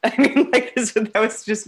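laughing. Real, not acted. Laughing at own reaction.

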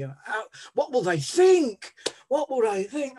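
retold inner panic; anxious panic. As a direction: spiral into worry about being seen.

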